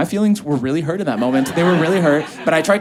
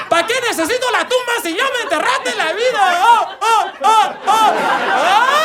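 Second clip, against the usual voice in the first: high, wailing sob-singing of a heartbroken man; mock-sobbing high lament; exaggerated weeping; 4 dB louder than usual. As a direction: wail it like a weeping ranchera singer. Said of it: "And then he weeps".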